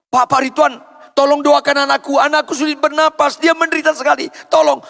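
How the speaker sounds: high, loud frantic parent; acted cry of urgent distress; desperate pleading. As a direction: beg for help for your sick child. Acts parent begging pastor for help.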